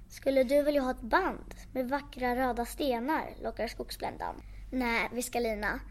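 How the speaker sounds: ordinary delivery, nothing marked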